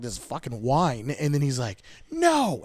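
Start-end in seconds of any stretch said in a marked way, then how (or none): 2.1-2.6 put-on high, whining toddler "No"; a little boy's whiny refusal. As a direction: mimic a two-year-old whining.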